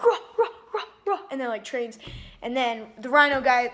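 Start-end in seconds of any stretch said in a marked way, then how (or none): 0.0-1.2 high, loud rah rah rah; mock battle cry. Angry panda training hard, mock yelling. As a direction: shout it like a furious fighter in training. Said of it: "He's like".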